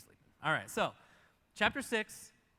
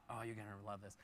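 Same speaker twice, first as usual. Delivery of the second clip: normal talking voice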